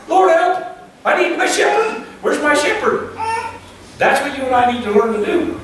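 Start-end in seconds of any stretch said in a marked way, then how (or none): 0.1-3.2 pleading sheep. Retold or imitated. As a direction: cry out for the shepherd. Sheep's bleat as prayer.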